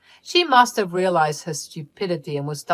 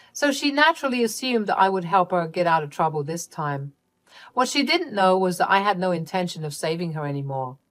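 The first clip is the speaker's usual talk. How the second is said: plain reading voice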